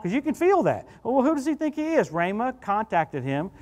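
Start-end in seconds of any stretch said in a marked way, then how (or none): 1.0-2.1 higher, indignant question; mocking doubters. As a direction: play the scoffing critics. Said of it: "feel people go".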